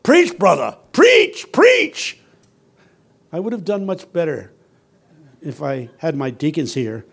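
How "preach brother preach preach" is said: high, loud shouts of encouragement; deacons' yell. Retold or imitated. Deacons yelling to the preacher when he says something good, loud yell. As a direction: holler it up like an excited deacon. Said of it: "the deacons yell".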